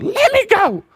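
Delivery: put-on very high squealing baby protest; fussy toddler. A sleepy baby pushing off his dad, squealing high. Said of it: "he'd push against me, like".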